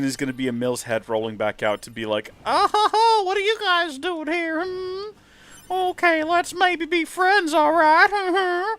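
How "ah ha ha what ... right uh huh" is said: put-on much higher, squeaky boyish voice, slowed on the friendly bit; Emil impression. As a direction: play a bashful little robot boy laughing nervously. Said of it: "That's not how Emil sounds".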